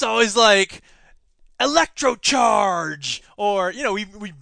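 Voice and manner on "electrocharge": slow, drawn-out ad-voice brand name; slow mock product announcer. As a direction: sell it like a cheesy ad. Mocking US brand names.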